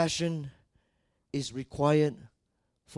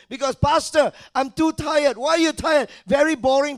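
loud, high-pitched pulpit shouting; preacher urging a church to keep serving, full-force preaching; 10 semitones higher, 15 dB louder than usual. Acted. Scolding the church with loud, raised voice.

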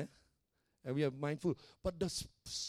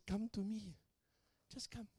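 soft, tender call, much quieter than the preaching; gentle invitation; 4 semitones higher, 9 dB quieter than usual. Retold or imitated. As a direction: invite softly as a caring shepherd. Gently voices Jesus inviting.

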